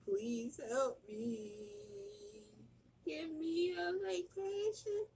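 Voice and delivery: high, slow, drawn-out mock wailing prayer; hammed-up wailing fit. Mom pleading with Jesus over her sick kids, hammed-up wailing. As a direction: throw a theatrical fit begging Jesus for rescue. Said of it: "I hope y'all enjoyed my little fit".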